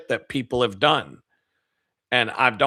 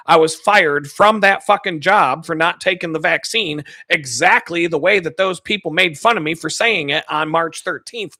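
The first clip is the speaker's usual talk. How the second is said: normal talking voice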